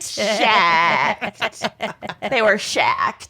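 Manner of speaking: slow Minnesotan "shacked" vowel; Minnesotan accent. Pretend. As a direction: play up the Minnesota twang. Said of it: "their worst Minnesotan accents".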